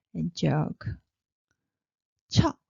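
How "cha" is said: "Cha" short with a sharp puff of air; burst of air. Shown as a demonstration. Teacher showing the burst of air, explosive "cha". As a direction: punch out an aspirated "cha". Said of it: "burst of air".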